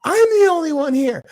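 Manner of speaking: high, loud mock rant of the worker; going off. Host mimicking the worker going off on him, high and loud. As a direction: snap it like she's going off on him. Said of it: "Going off on me".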